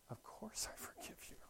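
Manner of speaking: offhand, near-whispered reassurance; casual. Retold or imitated. Father to son, casually forgiving in a hushed near-whisper. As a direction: shrug it off softly, like it's nothing. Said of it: "kind of casually".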